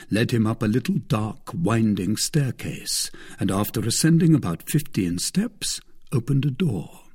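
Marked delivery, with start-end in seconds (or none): none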